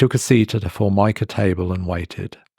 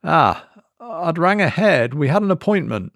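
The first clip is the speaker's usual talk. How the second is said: strained politeness; 8 semitones higher than usual. A narrator's reading. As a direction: keep smiling through it. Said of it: "His smile was working overtime".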